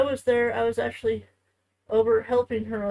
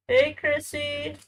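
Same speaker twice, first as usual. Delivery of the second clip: slow, higher, louder sing-song greeting; 5 semitones higher, 5 dB louder, slower than usual. Real, not acted. Calling her child over.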